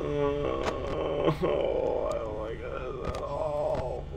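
soft, slow drawn-out whine; hushed real fear; frightened whimper. Real, not acted. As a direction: whimper it quietly, stretched with dread. Terrified player in horror game.

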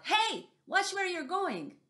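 high, loud indignant yell; little voice yelling. Acted. Startled owl yelling up at mouse deer. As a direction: snap at the clumsy stranger underfoot. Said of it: "a little voice yelled".

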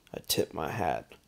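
plain reading voice

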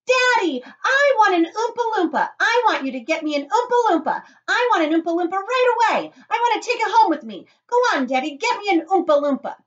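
put-on shouted spoilt-child demand; very high, loud, insistent whining yell; Veruca Salt. A narrator's reading. Spoilt girl demanding from her father, shouting. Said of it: "shouted Veruca Salt, the girl who got everything she wanted".

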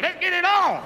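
high mock fight announcer; hammed-up ring shout; fight-starting call. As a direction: call the bout open in a strained high voice. Mimics fight announcer in ball pit.

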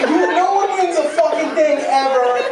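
normal talking voice

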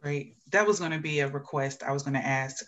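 usual speaking voice

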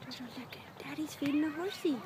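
hushed whisper. Real, not acted. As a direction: whisper it to the little one. Whispered aside to toddler.

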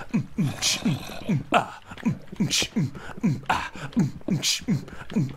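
mm and ch beats in rhythm; beatboxing. Real, not acted. Beatboxer laying down a steady beat. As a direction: keep the beat with lips and tongue.

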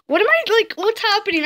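high, flustered what's happening; panicked confusion. Real, not acted. Gamer panicking at the game's turn, high and alarmed. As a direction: voice jumps up in alarm.

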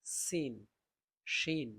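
usual speaking voice

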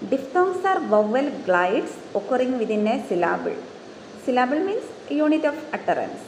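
very slow, deliberate textbook reading; dictating a definition slowly; slow dictation read. Shown as a demonstration. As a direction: read each word out for note-taking. Reads English definition slowly.

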